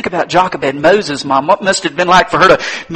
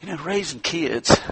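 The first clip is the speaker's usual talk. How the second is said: ordinary talk, nothing marked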